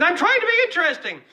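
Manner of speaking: high-pitched mock protest; comic outburst. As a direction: squeal it as a self-mocking plea. Self-mocking outburst after a laugh.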